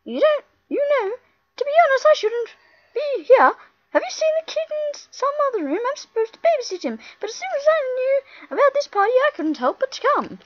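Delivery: game girl voiced up high; girl character voice. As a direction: play the sweet little helper girl.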